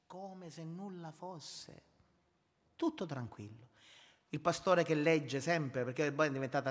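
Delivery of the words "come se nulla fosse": 1 quiet slow echo. Shown as a demonstration.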